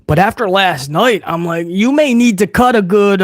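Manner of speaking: normal talking voice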